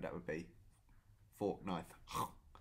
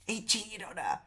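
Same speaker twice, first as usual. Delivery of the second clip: gossipy reveal; 12 semitones higher, 4 dB louder than usual. Retold or imitated. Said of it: "and I went".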